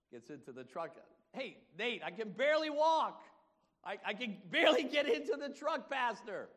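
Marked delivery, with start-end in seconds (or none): 1.3-6.5 high, loud, hammed-up complaint; exaggerated shouted protest; acted struggle. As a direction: cry it out as if every step is a struggle. Acts out exchange with struggling Nate.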